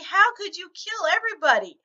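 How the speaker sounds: high, loud, accusing cry of disbelief; retold scream. Daughter storms downstairs yelling at her mother, high and loud. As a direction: shout it like a shocked teen. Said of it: "screaming at me".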